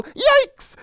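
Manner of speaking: very high, loud squeal; startled yelp. Acted. Rabbit bitten by mosquito, startled high yelp. Next animal yelps in the chain.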